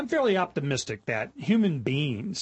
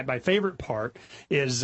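ordinary delivery

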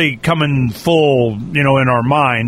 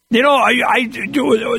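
ordinary talk

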